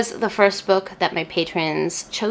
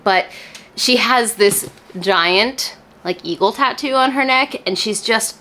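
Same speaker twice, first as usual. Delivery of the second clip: ordinary talk, nothing marked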